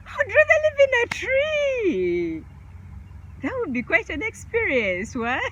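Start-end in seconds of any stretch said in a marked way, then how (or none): 0.1-2.4 high, slow, drawn-out answer; dreamy high sing-song. Acted. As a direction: float the words up high and slowly.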